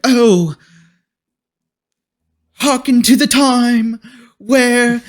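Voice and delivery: high, slow, hammed-up theatrical lament; mock Shakespearean actor. Mocking the cast's overwrought Shakespearean line delivery. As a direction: overact it like a theater kid doing Shakespeare. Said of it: "It was, like, Shakespearean".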